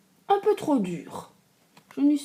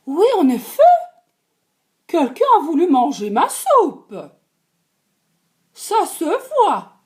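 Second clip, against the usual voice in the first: higher, louder mama bear; a firm motherly bear, pitched up; 6 semitones higher, 8 dB louder than usual. Acted. Mama bear agreeing about her soup, high and firm. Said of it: "La maman ours dit".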